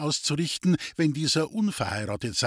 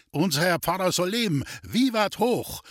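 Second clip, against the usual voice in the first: rousing cheer; 7 semitones higher than usual. Acted. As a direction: raise a cheer for the priest. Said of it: "rief Wendel".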